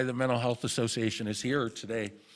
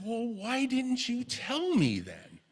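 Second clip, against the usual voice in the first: higher, slow, baffled question; baffled retort; 10 semitones higher than usual. Retold or imitated. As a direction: ask it slowly, half stunned. Son's retort to mom.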